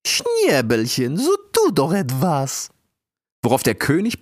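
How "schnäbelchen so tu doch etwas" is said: high, slow pleading queen voice; Königin Nita. Acted. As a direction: coax him slowly, high and sweet. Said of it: "als Königin Nita sprach".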